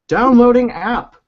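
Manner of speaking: high, slow robotic announcement; announcer voice. Pretend. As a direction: read it like a system announcement. Announcing app download.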